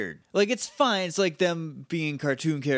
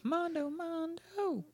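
high sing-song; 13 semitones higher, 10 dB quieter, slower than usual. Pretend. Playful echo of drink name.

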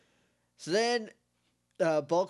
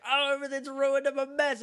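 much higher, hammed-up melodramatic lament; mock wailing woman; 10 semitones higher than usual. Woman covered in trash lamenting her ruined look, melodramatic. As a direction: whine it like a ruined damsel, overdone. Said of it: "She goes like".

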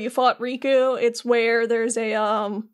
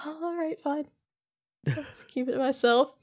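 much softer, deflated mumble; resigned giving in; 7 dB quieter than usual. Retold or imitated. Jiminy gives in after Sora's brush-off, quietly resigned. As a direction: back down quietly, imitating a hurt sidekick. Scolded character gives in, retold.

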